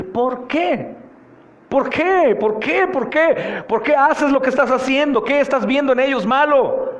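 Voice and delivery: high, insistent pulpit questioning; impassioned preaching. Acted. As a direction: hammer each question at the congregation. Preaches at full force.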